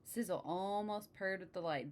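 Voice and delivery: ordinary delivery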